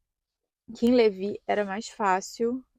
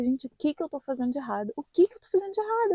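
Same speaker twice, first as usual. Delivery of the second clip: panicked inner voice; 6 semitones higher, faster than usual. Retold or imitated. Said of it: "eu ficava".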